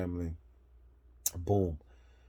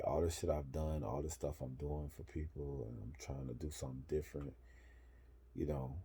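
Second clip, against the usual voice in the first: ordinary talk, nothing marked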